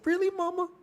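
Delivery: put-on childlike wonder. Son asking mom about ping, high mock kid voice. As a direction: squeak it like a wide-eyed child.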